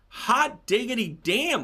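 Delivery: slow, drawn-out gleeful cheer; hot diggity, said with relish; delighted exclamation. Real, not acted. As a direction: savor it like good news.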